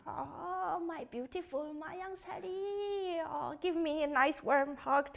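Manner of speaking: grandma softly calling her grandchild; tender grandma voice. Pretend. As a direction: coo the name like a doting grandma. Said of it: "please acting when you called your child with grandma's voice".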